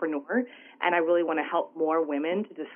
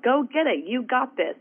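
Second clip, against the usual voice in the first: raised, punchy pep talk; mock cheering-on; 6 semitones higher than usual. Supportive family urging her on, imagined encouragement. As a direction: imitate a supporter urging someone on.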